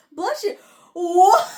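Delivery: high, loud, incredulous outburst; startled disbelief. Retold or imitated. Heroine reacting to a flower that sneezed, mock startled disbelief. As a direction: act out a startled reply jumping up in pitch.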